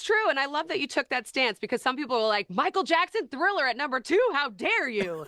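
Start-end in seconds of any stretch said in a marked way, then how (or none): 2.5-5.3 high-pitched, indignant disbelief; mock outrage. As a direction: imitate scandalized fans, pitched up. Mock outrage of list's critics.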